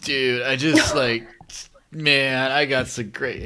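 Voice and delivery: dude-bro stoner drawl. Pretend. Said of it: "Can you at least put on a stoner voice?".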